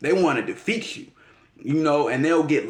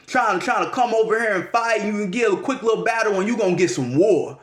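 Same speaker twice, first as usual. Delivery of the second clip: normal talking voice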